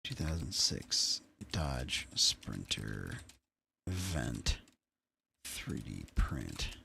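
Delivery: low, soft, slow word-by-word murmur; muttering to oneself. Real, not acted. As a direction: drag each word out quietly while typing a search. Talks to himself while searching.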